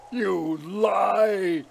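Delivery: high, choked accusation; half-stifled cry. A narrator's reading. Father accuses his son, in a half-stifled cry. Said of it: "in a half-stifled voice".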